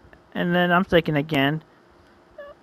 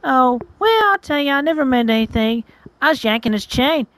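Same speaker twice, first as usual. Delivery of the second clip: put-on higher, airy girl's voice; dismissive girl; 5 semitones higher than usual. Girl confessing she was yanking his chain, dismissive. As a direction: toss it off in a raised pitch.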